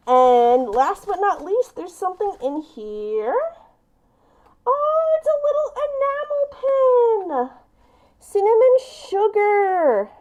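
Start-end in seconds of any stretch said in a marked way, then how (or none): 4.7-10.1 high, thrilled discovery of "it's a little enamel pin"; excited squeal. Real, not acted. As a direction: gasp with delight at the pin. Opening last gift, a pin.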